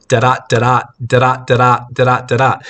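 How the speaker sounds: monotone repeated da-da da-da beats, evenly hammered; a flat, plodding da-da da-da cadence; droning da-da rhythm. Shown as a demonstration. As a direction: drone the da-da pattern like a metronome. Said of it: "droning rhythm of".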